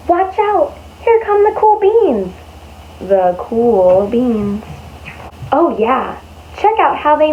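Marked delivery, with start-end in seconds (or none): 0.1-2.3 high, bright call as if to a crowd; excited announcement. A narrator's reading. Teacher reading to class, calls out excitedly. 3.0-4.6 low, slow, cool drawl. A narrator's reading. Teacher reading to class, slow cool drawl. Narrator voices cool beans.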